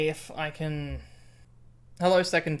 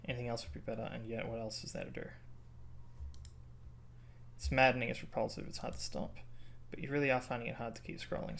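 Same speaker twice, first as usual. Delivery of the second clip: low, soft narration of the game's lines; game text reading; 5 semitones lower, 9 dB quieter, faster than usual. Acted. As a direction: read the story text quietly to yourself.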